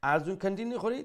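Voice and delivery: ordinary talk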